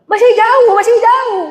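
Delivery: high, loud calls of masih jauh; attendant calling out. Retold or imitated. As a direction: shout reassurance to the driver. Parking attendant calling out distance. (tukang parkir berseru keras)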